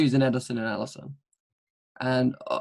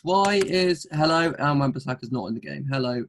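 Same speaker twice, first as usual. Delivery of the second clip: usual speaking voice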